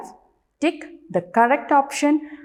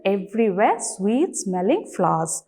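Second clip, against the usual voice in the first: normal talking voice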